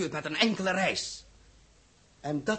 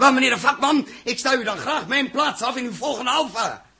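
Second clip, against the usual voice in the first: higher, louder mocking jab with a laugh; sarcastic retort; 7 semitones higher, 5 dB louder than usual. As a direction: scoff at the expert, laughing. Captain mocks the expert.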